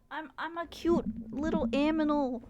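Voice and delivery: put-on high babyish cutesy voice; cute little animal. Streamer pretending to be a cute animal in costume, babyish. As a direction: play an adorable tiny creature. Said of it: "So I could be like".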